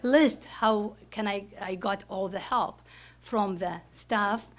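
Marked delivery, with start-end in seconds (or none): none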